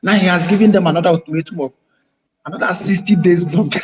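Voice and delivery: normal talking voice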